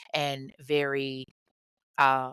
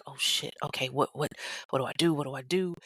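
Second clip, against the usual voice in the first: frozen panic; faster than usual. Retold or imitated. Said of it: "she's frozen".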